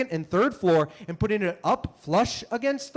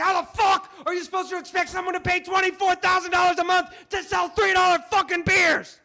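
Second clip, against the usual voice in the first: angry rant; 10 semitones higher than usual. Pretend. Comic ranting at City Hall over rent, laughing yell.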